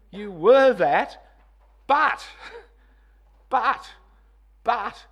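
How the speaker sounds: each but shouted high and loud. Shown as a demonstration. Preacher driving home the buts. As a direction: hammer every but. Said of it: "Hear the emphasis".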